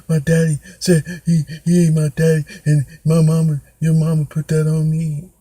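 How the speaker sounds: high, hiccuping mock sobs; hammed-up tearful wail, broken into gulps; imitated crying kid. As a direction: blubber it out like a kid too upset to talk. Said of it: "he's in that cry, like, he can't".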